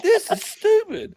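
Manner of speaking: very high, drawn-out whine of disbelief; squeaky, slow exasperated protest; comic exasperation. Acted. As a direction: wail it slowly in mock despair. Exasperated reply in retold argument.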